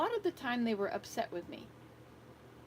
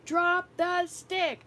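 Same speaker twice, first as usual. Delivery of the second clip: higher and louder as it builds, slow on the third; retold yelling; 7 semitones higher, 5 dB louder, slower than usual. Grandmother retelling yelling at the girl by the fire, building yell. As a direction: yell at the child more insistently each time. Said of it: "I'm yelling at her".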